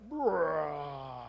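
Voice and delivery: a strained bodybuilder grunt, flexing; mock grunt. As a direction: push the sound out like flexing a bicep. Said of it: "the muscle builder goes like".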